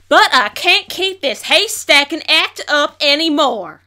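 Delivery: slow, loud outcry to the crowd; loud outburst. Acted. Farmer confessing to the exhibition crowd, loud and slow. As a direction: throw it out at the crowd.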